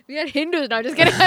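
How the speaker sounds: put-on joking in an Indian accent. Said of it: "we could do Indian accents all day".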